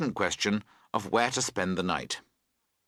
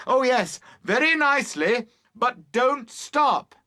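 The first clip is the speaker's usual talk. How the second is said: calling out; 12 semitones higher, 4 dB louder than usual. A narrator's reading. Boatman reassuring Emily, calling out. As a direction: call it out, slowing at the end.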